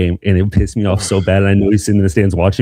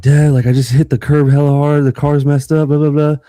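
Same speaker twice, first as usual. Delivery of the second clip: panicked call; 5 semitones higher than usual. Retold or imitated. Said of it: "I'm like".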